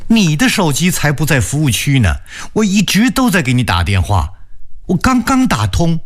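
young woman pleading on the phone, voiced high; anxious woman on the phone, pitched high; high female voice. A narrator's reading. Wenxin retorts on phone. (高声女声，焦急地问)

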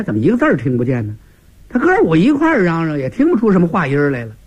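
usual speaking voice

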